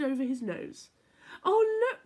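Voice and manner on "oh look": high, loud delighted cry; excited cry. A narrator's reading. Little Bear spots Rabbit in cotton wool, excited cry. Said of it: "cried Little Bear".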